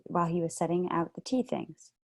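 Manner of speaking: plain reading voice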